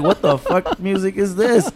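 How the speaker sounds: high, loud, slow complaint; drawn-out mock indignation; mock outrage. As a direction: imitate a customer baffled by what they hear. Mocks customers objecting to the music.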